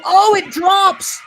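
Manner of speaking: high, loud burst of surprise at the drop; excited play call. Real, not acted. Commentator calls a dropped ball to listeners, excited.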